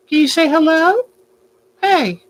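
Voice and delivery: high, sing-song coaxing of a pet; baby talk to a bird. Acted. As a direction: coax the bird sweetly, pitch up.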